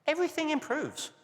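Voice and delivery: pitched well up on everything, proud and emphatic; emphatic claim. Real, not acted. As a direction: sell the headline result with a lift. Announces the key finding.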